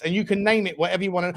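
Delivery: normal talking voice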